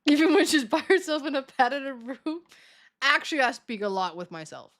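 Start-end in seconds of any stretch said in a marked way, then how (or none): 0.0-2.4 slow, loud teasing voice; a slow, loud, teasing jab; mock chat voice. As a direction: play the mocking viewer. Mocking chat voice about herself.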